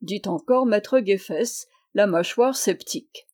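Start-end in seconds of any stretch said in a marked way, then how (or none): none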